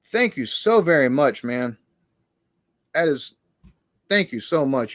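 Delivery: ordinary talk, nothing marked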